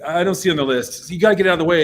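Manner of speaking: mock official brush-off; retold brusque refusal. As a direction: imitate an insistent gatekeeper turning you away. Gate girl refuses the band.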